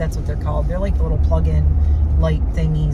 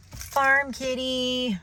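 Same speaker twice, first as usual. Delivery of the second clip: cooing pet voice; 6 semitones higher, 7 dB quieter, much slower than usual. Real, not acted. Cooing at cat for the dog.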